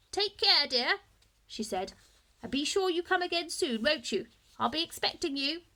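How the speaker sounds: put-on kindly old woman offering a lift; old lady. A narrator's reading. Old lady kindly offers the boy a ride home.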